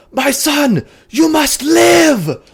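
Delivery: high, loud and slow; desperate scream. Acted. Father screaming at his dying creation, drawn-out desperate scream. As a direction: scream the command with everything. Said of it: "And he screams".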